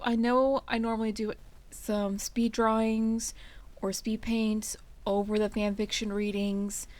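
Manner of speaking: ordinary delivery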